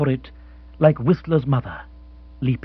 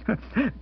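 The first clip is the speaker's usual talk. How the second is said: jolly chuckle; 8 semitones higher, 7 dB quieter than usual. Acted. Rory laughs at own joke.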